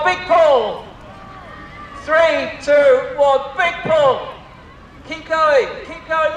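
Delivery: high excited call of big pull; tug of war. Real, not acted. Leading crowd tug of war.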